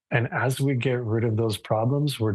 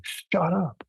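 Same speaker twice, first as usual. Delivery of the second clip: mild mock yell; 7 semitones higher, slower than usual. Telling students how he yelled at his dog, mild mock yell. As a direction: snap it at the barking dogs, lightly acted. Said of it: "I'm yelling".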